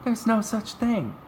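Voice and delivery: put-on very high girlish voice; objecting girl. Girl answering the boy's nonsense, high objecting voice. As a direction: refute it in a high-pitched girl's voice.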